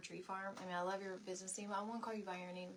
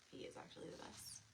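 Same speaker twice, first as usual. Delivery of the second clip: whispered aside; 15 dB quieter than usual. Real, not acted. Whispered aside about husband.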